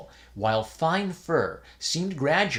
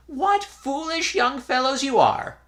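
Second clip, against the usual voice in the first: put-on high, louder scolding old man; scolding old priest; 13 semitones higher, 5 dB louder than usual. A narrator's reading. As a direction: chide the foolish boys.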